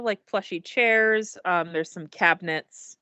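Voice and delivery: ordinary delivery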